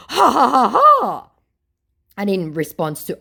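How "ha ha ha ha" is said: high, loud taunting ha-ha; a smug fake laugh mocking the victim; mocking scammer laugh. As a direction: cackle like you've just scored a point.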